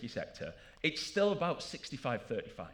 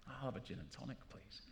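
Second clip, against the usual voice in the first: much softer, defeated order; meek retreat; 3 semitones lower, 14 dB quieter than usual. Retold or imitated. Scolded newcomer quietly giving up on whiskey, much softer. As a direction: shrink away and order something safe.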